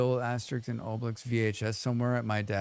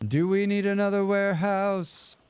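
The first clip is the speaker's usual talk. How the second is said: mock game voice; 5 semitones higher than usual. Streamer mocks the game's advisor for viewers, hammed-up put-on voice. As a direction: parrot the prompt in a put-on character voice. Repeating a game prompt aloud.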